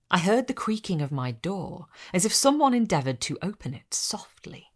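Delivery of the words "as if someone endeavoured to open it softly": ordinary delivery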